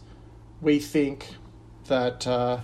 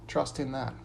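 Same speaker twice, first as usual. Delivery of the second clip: soft refrain; 7 dB quieter than usual. Acted. Pastor closing each verse with a soft refrain. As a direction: drop to a soft, settled close.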